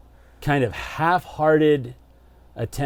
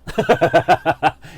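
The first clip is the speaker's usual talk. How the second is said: loud laugh; 6 semitones higher, 5 dB louder than usual. Real, not acted. Laughing at the dare.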